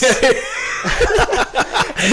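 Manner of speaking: loud, high burst of laughter. Real, not acted. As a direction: crack up laughing. Laughing at own impression.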